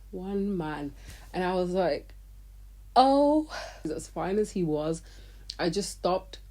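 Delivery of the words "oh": a high, loud, impressed oh. Retold or imitated. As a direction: gasp it out at a fine-looking man. Seeing one attractive man.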